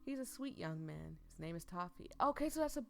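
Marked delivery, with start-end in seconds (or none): 2.2-2.8 high, loud burst of surprise; sudden high exclamation; surprised yelp. Real, not acted. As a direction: blurt it out, startled. Reacting to the new character.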